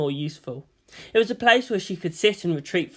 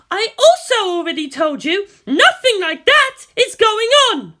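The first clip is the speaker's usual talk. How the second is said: much louder, higher exasperated scream, slowing at the end; 9 dB louder than usual. A narrator's reading. Misato screams at Asuka, much louder and higher. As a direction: blow up in frustration. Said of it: "Misato pulled at her hair and screamed".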